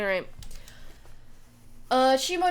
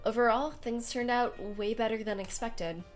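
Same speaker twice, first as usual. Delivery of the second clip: story narration; 5 dB quieter than usual. A narrator's reading. Reads visual novel narration.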